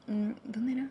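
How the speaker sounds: whispered to herself while remembering; whispered aside. Real, not acted. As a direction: whisper it, thinking aloud. Trying to recall a station name. (susurro pensativo)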